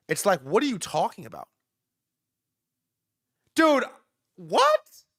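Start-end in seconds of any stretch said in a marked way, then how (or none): 3.5-5.0 high, loud disbelieving cry; shocked. Real, not acted. Streamer reacting to the news, loud shocked disbelief. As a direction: blurt it out in shock.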